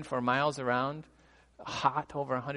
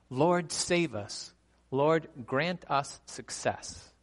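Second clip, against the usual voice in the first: scripture reading; 3 semitones higher, much slower than usual. Acted. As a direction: proclaim the verse slowly, each phrase weighted. Reads psalm verse aloud.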